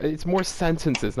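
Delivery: ordinary delivery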